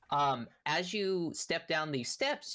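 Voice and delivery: ordinary talk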